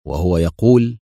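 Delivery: ordinary delivery, nothing marked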